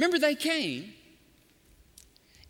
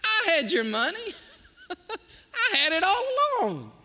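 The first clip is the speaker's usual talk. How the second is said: higher, louder laughing reveal; 9 semitones higher, 4 dB louder than usual. Retold or imitated. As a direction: laugh as you let them in on it. Steward laughs, revealing money.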